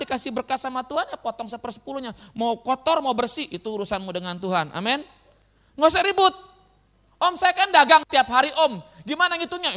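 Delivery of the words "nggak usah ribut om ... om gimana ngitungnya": raised, higher voice of a fussing churchgoer; acted loud protest. Preacher acting out a trader quarrelling over tithes, loud. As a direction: argue noisily, in mock exasperation.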